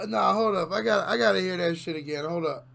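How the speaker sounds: usual speaking voice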